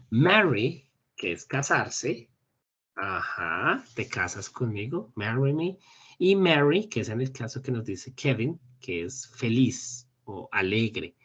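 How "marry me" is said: normal talking voice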